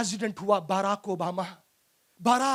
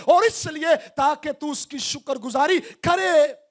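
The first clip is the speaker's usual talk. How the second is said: raised, driving sermon delivery at full force; fervent preaching; 5 semitones higher, 5 dB louder than usual. Acted. Preacher urging the soul not to forget God's blessings, impassioned. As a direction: build higher and louder, exhorting the soul to remember.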